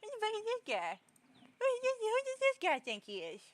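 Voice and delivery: high, loud mock indignation; playfully outraged at the cat; mock outrage. Real, not acted.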